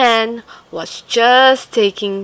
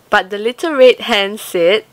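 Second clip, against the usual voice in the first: usual speaking voice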